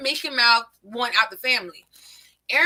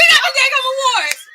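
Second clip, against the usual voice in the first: much higher, loud incredulous laughing yell; excited shout; 12 semitones higher, 5 dB louder than usual. Real, not acted. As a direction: shout it in amused disbelief. Laughing disbelief at the awards.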